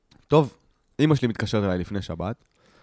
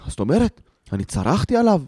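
higher-pitched mock explanation; imitated father clarifying; 7 semitones higher than usual. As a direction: play dad casually correcting himself. Father clarifies whom he phoned.

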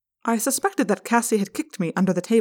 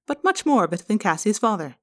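ordinary delivery

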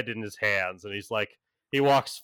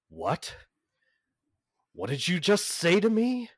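incredulous angel; 6 semitones higher than usual. Acted. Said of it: "He's like".

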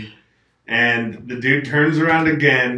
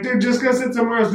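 impatient demand; 9 semitones higher, much faster than usual. Retold or imitated. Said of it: "I'm like".

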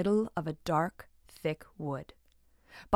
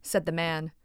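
usual speaking voice

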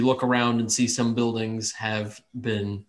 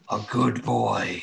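cold rasping evil emperor; slower than usual. Acted. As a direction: menace him slowly like a dark deity. Deity voice praises.

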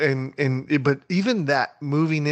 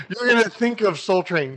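ordinary delivery

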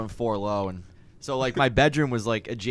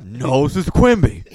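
slow goofy Patrick voice; 8 dB louder, slower than usual. Retold or imitated. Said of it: "And SpongeBob it".

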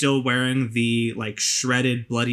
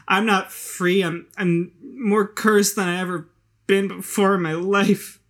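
put-on despairing drunk woman; 8 semitones higher, 4 dB louder than usual. GM voices Hazel.

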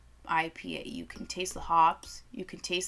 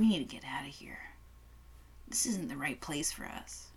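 barely voiced, much softer aside; creeped-out whisper; 5 semitones lower, 9 dB quieter than usual. Telling her friend in the store it's weird, whispered. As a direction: breathe it to your friend so nobody hears.

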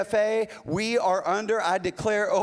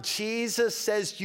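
ordinary delivery

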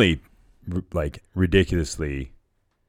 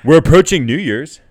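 excited announcement; 10 semitones higher, 10 dB louder than usual. Real, not acted.

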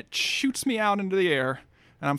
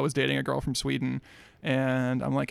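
ordinary talk

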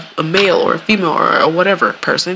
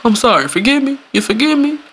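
whiny apologizer; 7 semitones higher than usual. Retold or imitated. Mimicking apologizer.

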